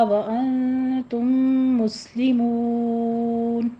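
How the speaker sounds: slow, melodic tajweed chant; Quran recitation. Shown as a demonstration. Teacher recites verse one thirty-two for the class, chanting. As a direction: recite the verse slowly with long held vowels.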